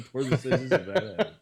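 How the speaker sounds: laughing through the words. Real, not acted. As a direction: echo it mid-laugh. Echoes the joke while laughing.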